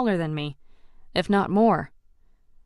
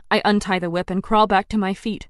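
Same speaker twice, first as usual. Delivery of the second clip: usual speaking voice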